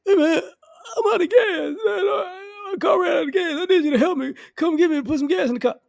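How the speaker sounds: high, crying plea for gas; whiny begging friend. Retold or imitated. Stranded friend whining to the comedian for gas, high crying plea. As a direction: beg like a helpless stranded buddy.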